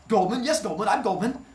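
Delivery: loud, high, flustered blurting. Retold or imitated. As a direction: blurt it out all at once. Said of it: "all in one word".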